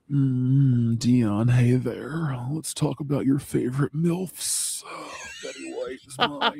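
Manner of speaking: slow, low, husky drawl with a breathy hum; Buffalo Jeff voice. Shown as a demonstration. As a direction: draw it out like a lazy bison. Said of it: "read the top search sex terms in a video as Buffalo Jeff, Buffalo Jeff voice".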